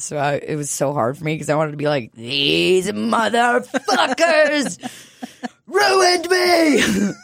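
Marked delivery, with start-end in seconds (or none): 2.1-7.1 drawn-out cry, climbing to high; mock anguished wail. Client bursting out about trauma, mock anguished wail. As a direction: wail it out, hammed-up. Acting out an urge to rant.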